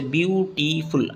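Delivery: "Beautiful" slowly, broken into its syllables; syllable breaks. Shown as a demonstration. Teacher showing pupils a long word, "beautiful" slowly broken up. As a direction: say "beautiful" in pieces, pausing two or three times. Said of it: "बोलने में हमको दो तीन बार रुकना पड़ा".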